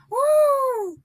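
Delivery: long, very high woo; amused whoop. Real, not acted. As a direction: let out a high overwhelmed whoop. Reeling from the jab.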